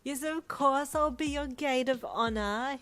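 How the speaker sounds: high, loud gushing voice; overjoyed, hammed-up acceptance; Brian, gushing. A narrator's reading. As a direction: friend accepting the maid of honour role, high and gushing. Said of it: "he replied".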